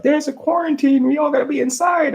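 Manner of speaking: very high, imitated quarantine announcement; hammed-up high-pitched breaking news to everyone; mock alarmed announcement. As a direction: squeal it like an exaggerated warning.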